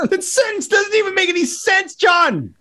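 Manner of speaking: yelling through laughter; laughing shout. Real, not acted.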